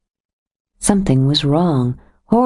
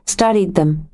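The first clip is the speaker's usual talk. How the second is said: normal talking voice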